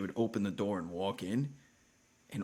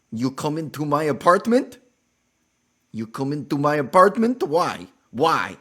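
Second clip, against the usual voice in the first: put-on loud, high Russian-accented demand; 7 semitones higher, 13 dB louder than usual. Neighbor Olga demanding answers at the door, loud Russian accent. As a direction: confront them in a thick Russian accent. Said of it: "in her Russian, Ukrainian accent".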